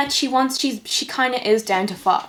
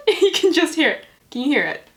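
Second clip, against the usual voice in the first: still giggling; 10 semitones higher than usual. Real, not acted. Still laughing.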